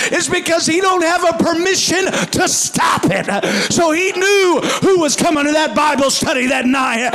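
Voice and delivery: loud, high preacher's shout; full-force sermon climax; preaching. Acted. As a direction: roar it out at the congregation.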